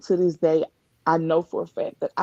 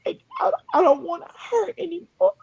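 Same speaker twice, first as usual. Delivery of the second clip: tearful plea; 9 semitones higher than usual. Real, not acted. Reliving her tearful prayer.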